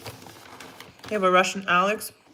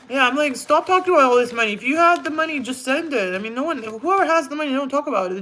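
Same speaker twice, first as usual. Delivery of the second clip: higher and louder, fed up with all the money talk; exasperated rant; 5 semitones higher, 3 dB louder than usual. Real, not acted. Streamer scolding chat about money, exasperated and loud. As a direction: vent it, building your frustration.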